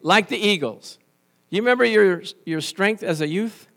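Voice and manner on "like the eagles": loud, slow scripture reading; preacher reading the verse aloud, slow and loud. Shown as a demonstration. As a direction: proclaim the verse slowly and loudly. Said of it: "Let's see what it says".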